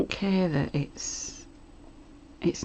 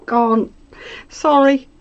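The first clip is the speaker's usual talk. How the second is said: laughing; 9 semitones higher, 11 dB louder, slower than usual. Real, not acted. Laughing apology after quote.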